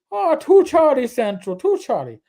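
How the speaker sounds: lilting put-on Irish brogue; radio call in a playful Irish accent. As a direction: start high and loud in an Irish accent, then settle. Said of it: "I wanted to do one on Saint Patty's Day".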